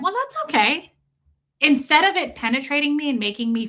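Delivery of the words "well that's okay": high, light brush-off; cheerfully unbothered; breezy okay. Retold or imitated. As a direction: toss it off high and easy. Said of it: "And I went".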